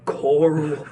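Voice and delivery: gravelly superhero growl, rising; Batman voice. Pretend. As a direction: do Rick calling Carl as Batman. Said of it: "saying Carl in the Batman voice".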